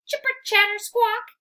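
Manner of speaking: high, loud chattering squirrel; a chattering squirrel's taunt; squirrel screech. Acted. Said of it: "he screeches".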